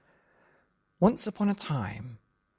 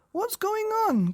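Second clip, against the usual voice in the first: waking dad; 3 dB louder than usual. Acted. Woken dad asks confused.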